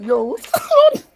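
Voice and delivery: see red lights and freak out; shocked yo. Retold or imitated. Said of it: "I'm like".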